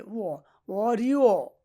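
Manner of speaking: উও then অরিও, slowly drawn out as "wo, o-ri-wo"; teacher sounding out অরিও slowly; demonstrated pronunciation. Shown as a demonstration. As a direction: stretch the vowels of অরিও. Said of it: "এখানে উও হবে".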